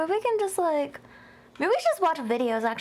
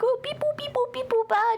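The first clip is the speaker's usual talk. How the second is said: robot beeps; 9 semitones higher than usual. Retold or imitated. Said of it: "so I was just like".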